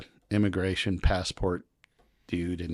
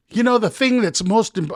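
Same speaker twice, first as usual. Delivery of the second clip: much higher and louder, rushed; mock nervous answer; 13 semitones higher, 8 dB louder than usual. Traveler dodging the officer's question, high nervous blurt. First attempt at his answer.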